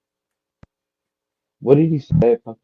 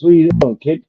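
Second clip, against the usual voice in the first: gruff butcher; 7 semitones higher, 5 dB louder than usual. Acted. Joke, butcher questions kid.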